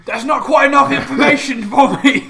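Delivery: loud, high, breaking into a laugh; loud exasperated. Acted. Talazar pressing Jack for answers, loud and amused. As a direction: push back loudly, half laughing.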